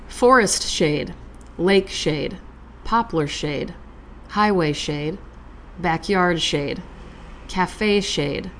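slow, soft, measured recitation with long pauses; poem reading. Acted. Reading a favorite poem to her podcast listeners, slow and soft. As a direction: let each image settle before the next. Reads Anne Carson poem aloud.

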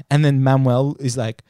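ordinary delivery

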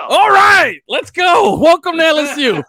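loud, high, whooping mock enthusiasm; mock excited welcome. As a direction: burst into an over-the-top welcome. Playing fans greeting the accented coach.

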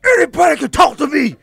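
high, loud, animated shout; hammed-up animated shout; animated imitation. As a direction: get fired up and yell it. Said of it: "animated and".

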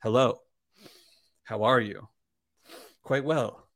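measured greeting with long pauses; imitating a solemn, halting courtesy; Richard Patterson. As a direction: chat politely to a glass, pausing after each phrase. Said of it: "just the way he, like, speaks to the whiskey".

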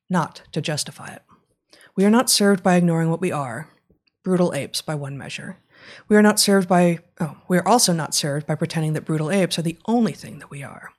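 measured reading aloud from the book. Acted. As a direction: read it like an audiobook passage. Reads book section aloud.

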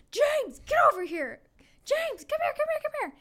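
loud, high call across the kitchen. Retold or imitated. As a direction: summon James loudly from the back. Worker calling James over.

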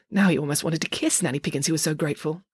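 usual speaking voice

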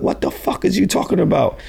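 high, alarmed disbelief; shocked disbelief. Retold or imitated. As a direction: yelp it in alarm. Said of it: "I'm like".

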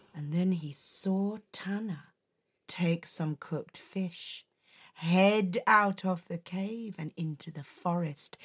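plain reading voice

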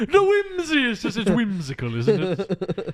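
high, loud put-on whimsical voice; hammed-up whimsy. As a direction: gush with mock delight, way up high.

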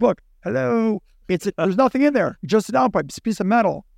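retold incredulous protest; exasperated protest. Acted argument with airport security.

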